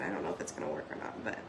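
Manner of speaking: whispered aside to herself; whispering. Real, not acted. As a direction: breathe it out without voice. Aside muttered while crafting.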